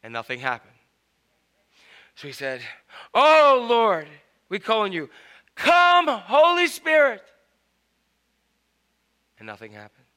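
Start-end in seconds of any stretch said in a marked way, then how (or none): 3.2-7.2 loud, high pleading summons; insistent invocation. Acted. Evangelist after nothing happened, insistent invocation. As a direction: plead grandly, then swell on the call.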